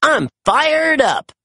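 high, slow, pumped cry; fired-up declaration. A narrator's reading. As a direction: burst with eagerness. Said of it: "Marshall declared".

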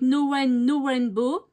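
English read with a French accent. Real, not acted. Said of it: "avec mon accent anglais". (anglais avec l'accent français)

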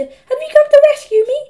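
put-on very high, loud little boy; squeaky hopeful plea. A narrator's reading. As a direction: squeak hopefully to your rescuer. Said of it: "squeaked Peter".

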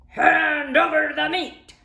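pirate demand. Acted. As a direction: demand it with mouth full of greed. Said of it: "gulped his food and said".

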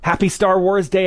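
ordinary delivery

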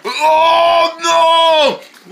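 high despairing shout; high wail. Acted. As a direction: wail in dismay.

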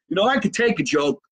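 high-pitched good-humoured protest. Real, not acted. Comedian answering a viewer's jab, high and good-humoured. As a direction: pitch it up, just after laughing.

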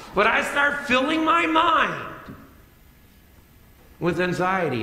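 high, loud, rising preacher's build; fired-up preaching. Acted. Pastor to congregation, building a high, loud preaching climax. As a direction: whip the congregation up over the rainy Friday.

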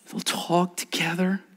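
hushed whispered phrase. Acted. Pastor whispering to the congregation. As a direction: breathe it out in a whisper.

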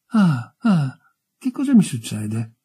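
two startled gasps, then a bewildered question; frightened gasping. A narrator's reading. Newly buried youth wakes in the grave, frightened and gasping. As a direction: gasp in mild panic. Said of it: "Ansimò all'improvviso con tono spaventato una vocina nuova".

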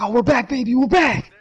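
high, gleeful whooping delivery; mock celebration. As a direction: imitate someone crowing over a comeback. Acting out a celebrating character.

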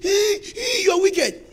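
high mock wail; hammed-up protesting cry; imitating a wailing victim. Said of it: "He said".

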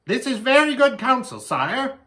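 high, loud bird croak; croaking raven. A narrator's reading. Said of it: "croaked the raven".

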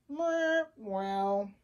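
muffled, drawn-out mumble; mouth full. Acted. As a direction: talk as if chewing on something. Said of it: "talk with your mouth full".

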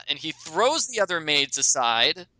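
ordinary talk

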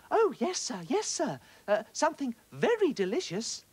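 high and sweetly eager; eager little fish. A narrator's reading. Little fish pretending to obey the octopus, high and eager. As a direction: play along sweetly to fool him. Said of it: "said the little fish".

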